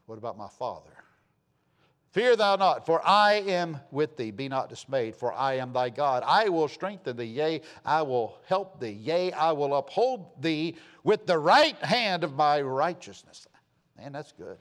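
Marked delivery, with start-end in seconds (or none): 2.1-13.4 emphatic, stretched-out preacher's verse; scripture proclamation. Acted. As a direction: proclaim it with weighty, drawn-out stresses.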